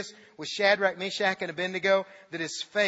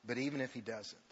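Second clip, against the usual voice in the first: hushed; 7 semitones lower, 10 dB quieter than usual. Acted. Preacher's hushed key line.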